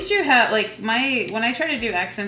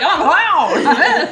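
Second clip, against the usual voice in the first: goofy clown voice; 8 semitones higher, 10 dB louder, much slower than usual. Pretend. Mocking a clown-in-plain-clothes persona.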